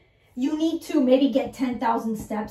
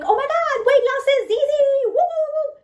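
much higher and louder, mocking forced cheer; hammed-up hype; 12 semitones higher, 4 dB louder, faster than usual. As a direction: parody an overeager cheerleader. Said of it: "You don't have to be like".

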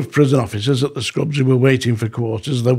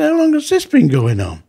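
shocked question; 10 semitones higher than usual. Retold or imitated. Wife tells about louts.

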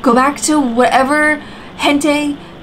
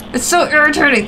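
ordinary talk, nothing marked

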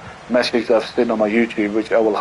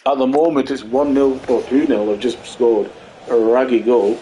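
ordinary delivery

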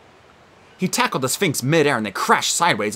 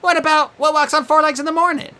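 put-on girl's voice; 4 dB louder than usual. Annabeth voiced.

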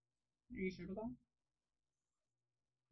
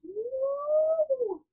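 high, drawn-out "woo!"; excited whoop; 5 dB louder than usual. Real, not acted. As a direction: cheer with one long high whoop. Cheering about the flower dance.

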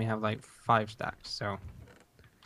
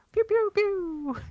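gun sound effects; 4 dB louder, much slower than usual. Pretend. Gun noises, slow high.

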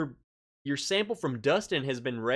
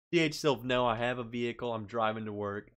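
ordinary talk

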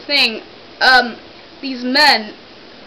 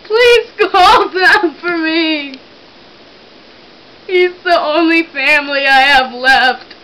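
fake sobbing plea; 3 semitones higher, 4 dB louder than usual. Prank caller fakes an emergency.

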